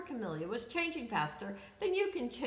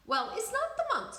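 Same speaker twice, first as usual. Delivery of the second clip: pompous specialist; 10 semitones higher, 3 dB louder than usual. A narrator's reading. Specialist gives verdict.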